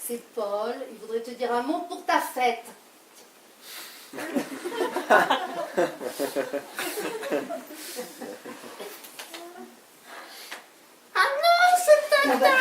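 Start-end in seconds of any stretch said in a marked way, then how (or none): 4.1-8.9 stifled laugh. Acted. Lover put on phone, laughs. 11.1-12.5 much higher put-on voice of an old aunt; fake old aunt voice. Lover pretending to be the aunt for Paul, high put-on voice. Mimics voice to fool husband.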